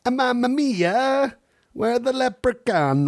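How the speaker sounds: put-on high, slow Italian-Irish-Jamaican mashup accent. Mom showing off her accent mashup, high and hammed-up. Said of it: "that was, like, Jamaican, Irish, and Italian".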